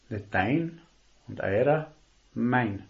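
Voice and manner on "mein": "mein" alone, firm and falling; stress on mein. Shown as a demonstration. Preacher contrasting dein and eurer, firm falling "mein". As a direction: set "mein" apart with a firm fall. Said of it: "Die Betonung liegt auf mein".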